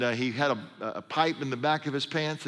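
usual speaking voice

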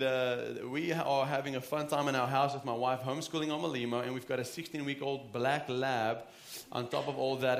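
ordinary delivery

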